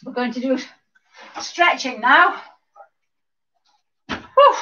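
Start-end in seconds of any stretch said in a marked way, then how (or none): 4.1-4.6 high, loud exhaled whew; puffed-out whew. Real, not acted. Instructor after the fast dance routine, puffed whew. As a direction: blow out a relieved breath after exertion. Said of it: "Quite puffed after that".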